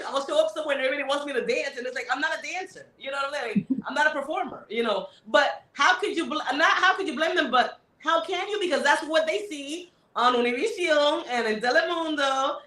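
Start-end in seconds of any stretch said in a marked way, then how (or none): none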